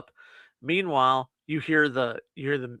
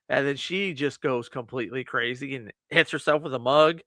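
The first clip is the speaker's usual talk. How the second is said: ordinary delivery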